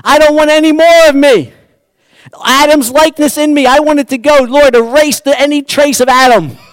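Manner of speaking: high, loud, fervent plea; pleading cry to God. Acted. Preacher voicing Wesley's loud, fervent cry to God. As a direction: cry out to God to erase the self. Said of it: "He's saying".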